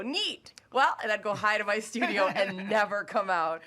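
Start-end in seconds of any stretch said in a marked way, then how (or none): 0.0-0.5 high, swooping one-word brush-off; mock-pleasant, pitched up high; awkward polite reply. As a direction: imitate an uneasy, too-polite response. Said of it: "and I'd just go".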